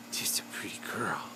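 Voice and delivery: hushed adoring whisper. Real, not acted. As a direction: whisper it to the pet. Cooing over the parrot's wings.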